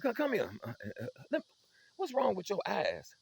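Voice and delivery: high, slowing interrogation; a mother squinting into her son's eyes; suspicious mama. Retold or imitated.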